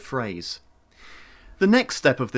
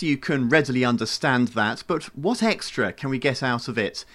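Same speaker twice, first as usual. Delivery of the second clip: ordinary talk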